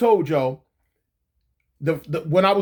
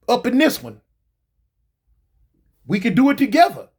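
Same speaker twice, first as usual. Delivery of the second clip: raised, higher lecturing voice; mock scolding; 4 semitones higher, 3 dB louder than usual. Husband scolding his wife at the front door, raised and higher. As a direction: tell her off like an angry husband.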